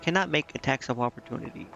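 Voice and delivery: normal talking voice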